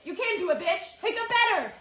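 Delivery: loud high drill-sergeant yelling. Pretend. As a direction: bark orders at her. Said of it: "yell at me".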